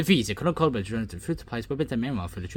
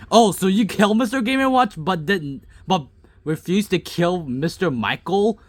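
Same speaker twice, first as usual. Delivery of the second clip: high, loud, accusing question; indignant disbelief; 9 semitones higher, 6 dB louder than usual. Real, not acted. Gamer challenging a character's choice of victim, indignant. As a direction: call out the double standard.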